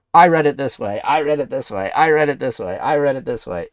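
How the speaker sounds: loud, mocking chant of every know-it-all reader; mocking collectors. Streamer mocking everyone's takes on the Poke Beach article, loud mockery. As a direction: parrot them over and over, loud and mocking. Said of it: "everybody takes the sa-, a different, well, I".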